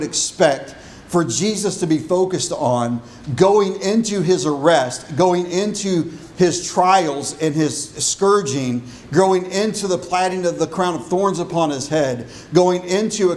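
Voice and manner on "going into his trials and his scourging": usual speaking voice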